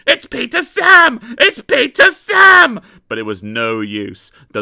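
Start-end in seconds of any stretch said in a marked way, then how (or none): 0.1-2.8 very high loud wail; wailing trucks. A narrator's reading. Empty trucks wailing in fear at the runaways. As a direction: cry out in panic. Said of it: "wailed the empty trucks".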